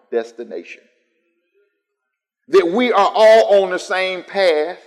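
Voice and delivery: loud, high, rolling sermon cadence; full-force preaching. Acted. Pastor rebuking the world's eclectic faith, full-force preaching. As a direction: lift the church with each line.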